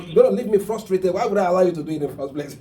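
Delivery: high, loud, rapid complaint; frustrated protest. Retold or imitated. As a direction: voice a wife's fed-up objection. Voicing a frustrated wife's protest.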